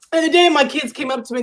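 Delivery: ordinary delivery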